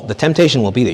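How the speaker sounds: ordinary talk, nothing marked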